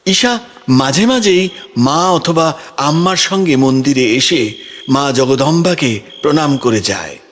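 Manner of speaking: ordinary delivery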